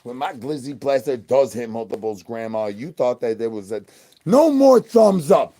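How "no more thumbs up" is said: loud, slow, high scolding yell; shouting at viewers. Real, not acted.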